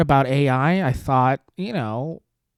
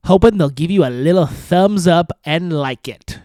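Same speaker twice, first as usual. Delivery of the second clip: mock Zelda voice; 3 dB louder, slower than usual. Host mocking Zelda's statement to viewers, slow snooty put-on voice. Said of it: "This is how she sounds".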